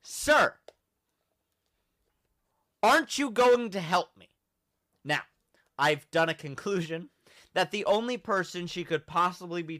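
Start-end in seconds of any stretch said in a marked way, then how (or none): 0.0-4.3 mock plea. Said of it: "And she goes".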